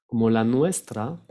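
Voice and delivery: usual speaking voice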